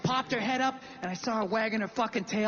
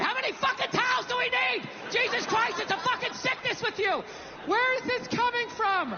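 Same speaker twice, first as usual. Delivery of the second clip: high, ranting exasperation; mock angry outburst; 10 semitones higher than usual. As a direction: blow up over nothing, pitched way up. Imagined future outburst at loved one.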